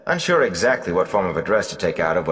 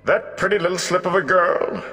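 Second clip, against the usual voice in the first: put-on high, amused exclamation as an old bard; exclaiming Gleeman; 13 semitones higher than usual. Old bard marvels at the village wisdom, exclaiming. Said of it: "the Gleeman exclaimed".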